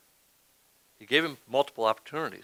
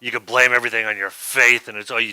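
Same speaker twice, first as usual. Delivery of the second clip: loud, dismissive mock of a critic; lightly voiced scoffing critic; mocking sceptic; 9 dB louder than usual. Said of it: "I've had people tell me".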